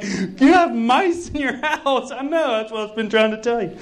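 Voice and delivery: playing it up, stunned; surprised retold exchange; mock astonishment.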